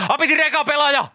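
high shouted game call, "Apitir eka pelaaja!"; loud playground call; childhood game call. Retold or imitated. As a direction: yell it like a kid claiming first turn.

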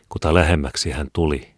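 ordinary delivery

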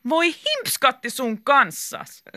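high, drawn-out mock scolding; stretched, high-pitched exclamation; exasperated outburst. A narrator's reading. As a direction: cry it out slowly with emphasis. Krista's cheer at the game.

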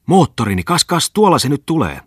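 high, loud burst of joy; sudden joyful cry. A narrator's reading. Bungman spots his motorboat, high joyful exclamation. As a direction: cry out in delight as the boat appears. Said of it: "huudahti yhtäkkiä".

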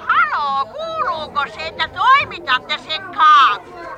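loud, insistent demand; impatient phone call. Acted. Anxious customer checking a cake order by phone, loud and impatient. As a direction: make sure they hear you down the line.

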